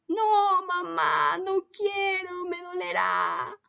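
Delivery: high, slow frightened whine; scared child pleading. Acted. Joaquín begs his mom not to vaccinate, frightened whine. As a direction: plead like a scared little boy.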